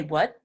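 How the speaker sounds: "What?" with the T swallowed; unreleased T. Shown as a demonstration. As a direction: stop "What?" without releasing the T. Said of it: "native English speakers will say".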